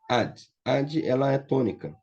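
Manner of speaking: normal talking voice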